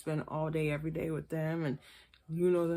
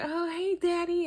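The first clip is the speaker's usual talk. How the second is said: put-on very high, louder girlish coo; sugary phone greeting; 12 semitones higher, 4 dB louder than usual. Maila greeting Quaylon on the phone in a very high sugary coo. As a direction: squeal it sweetly like she's thrilled he called. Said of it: "she'd be like".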